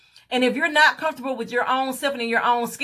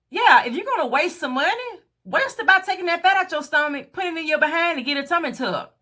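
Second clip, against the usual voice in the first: higher, sing-song mock of the friend; mocking repeat; 5 semitones higher than usual. Repeating the friend's advice back to viewers, higher mocking sing-song. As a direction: parrot her words back with scorn.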